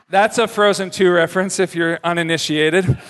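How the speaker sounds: ordinary talk, nothing marked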